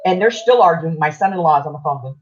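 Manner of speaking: ordinary talk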